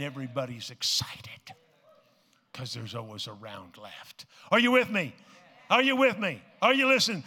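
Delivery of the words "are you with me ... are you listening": loud high call to the crowd. Real, not acted. Preacher calling out to the congregation, loud. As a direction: rouse the crowd.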